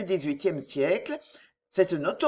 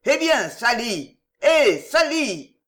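higher, louder and slow, drawn-out shouts of Sally; noisy impatient calls; 4 semitones higher, 6 dB louder, slower than usual. Acted. Drinkers in the tap room calling loudly for Sally. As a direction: bellow for the barmaid impatiently. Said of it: "les bruyants appels".

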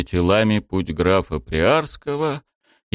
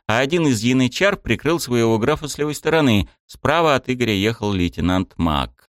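ordinary delivery, nothing marked

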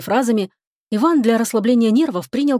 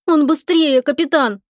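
higher-pitched, whining protest; plaintive complaint; 6 semitones higher, 3 dB louder, slower than usual. A narrator's reading. Lika complains to the captain about the faster rival, plaintive. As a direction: complain to your superior in a raised voice. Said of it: "пожаловалась Лика".

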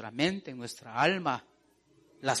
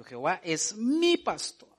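"mi" held long and pitched up; énfasis en mi; 2 semitones higher than usual. Shown as a demonstration. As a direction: punch and stretch the word "mi". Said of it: "es mío". ("mi" recalcado y alargado)

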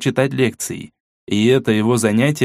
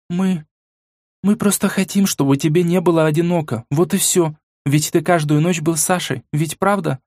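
put-on high, hurried girl's excuses; a young girl protesting; flustered girl voice; 6 semitones higher than usual. A narrator's reading. Mila voiced higher. (смущённый девичий голос)

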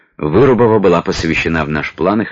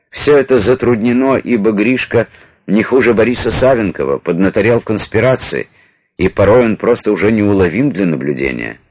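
usual speaking voice

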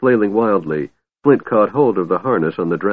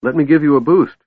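high, loud, eager offer of help; helpful; 9 semitones higher, 5 dB louder, faster than usual. A narrator's reading. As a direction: pipe up keen to lend a hand. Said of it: "Tass said helpfully".

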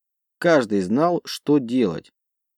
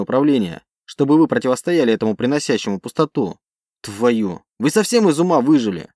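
loud exasperated retort; heated argument; 3 dB louder than usual. Acted. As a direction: push back hard at the boss. Pilot argues with commander.

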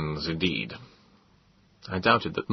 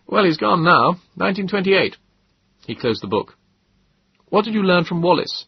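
higher, amused scholarly gentleman; Moses's voice; 10 semitones higher, 4 dB louder than usual. A narrator's reading. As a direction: lift the voice for a wry, quoting friend. Moses again.

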